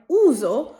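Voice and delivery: উজো said slowly with a buzzing z; voiced z. Shown as a demonstration. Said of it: "উজোতেও এস আমরা জ উচ্চারণ করছি".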